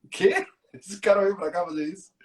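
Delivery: a high, incredulous yelp on Quê, then scoffing; startled disbelief. Retold or imitated. Mexican producer scoffs at the Brazilian actor, incredulous. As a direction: sound shocked that he came to do this. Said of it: "Aí ela olhou pra mim e falou".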